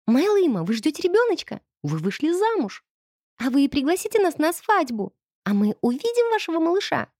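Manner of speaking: put-on high eager girls' voices; excited little girls. A narrator's reading. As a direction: pepper her with questions. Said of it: "Засыпали меня вопросами девочки".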